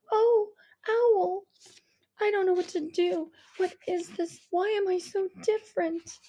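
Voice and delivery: put-on a lost girl pleading for answers; upset daughter. A narrator's reading. Daughter asking her mother why she is different, distressed. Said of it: "frightened daughter asks Mom".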